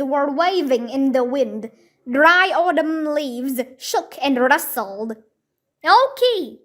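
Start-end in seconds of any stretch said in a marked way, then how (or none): 5.8-6.6 yell. A narrator's reading. Jack yelling for the lost puppy in the woods. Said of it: "yelled Jack".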